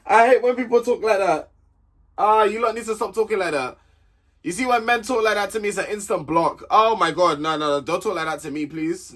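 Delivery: high-pitched, appalled protest; exasperated disgust. Real, not acted. Vlogger begging people not to talk like that, exasperated. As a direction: plead against it, pitched well up. Ranting about flirty talk after scream.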